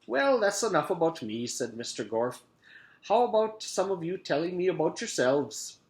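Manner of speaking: a hint of a cheerful teacher; lightly voiced teacher. A narrator's reading. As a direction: answer like a pleasant schoolteacher. Mister Gorf voice.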